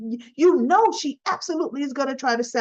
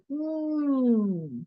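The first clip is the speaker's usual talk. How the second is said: a doubtful closed-mouth hmm, higher pitched; skeptical hum; 4 semitones higher, 4 dB quieter than usual. Retold or imitated. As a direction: hum your doubt at her. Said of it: "as she's kinda going off the handle".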